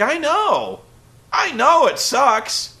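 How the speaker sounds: high, slow mock sigh of agreement; weary agreement. Vlogger siding with Cole, slow and high in mock weariness. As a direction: agree like you've had enough too. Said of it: "And I'm like".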